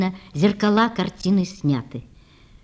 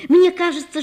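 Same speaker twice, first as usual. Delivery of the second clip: high, rising rush of feeling; eager outburst; 9 semitones higher, 4 dB louder than usual. A narrator's reading. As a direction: blurt it out with fresh fervour. Natasha confesses her love.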